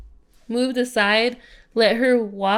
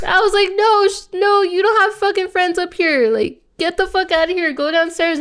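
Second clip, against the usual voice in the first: pitched way up, fast and bossy; high mock telling-off; 9 semitones higher, 3 dB louder than usual. Telling the drunk girl to leave, high bossy voice. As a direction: bark her down the stairs, high.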